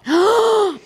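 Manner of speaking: high, sharp gasp of horror; real startled intake of breath; shocked gasp. Real, not acted. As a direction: gasp at a creepy revelation. Shocked by the snake nest.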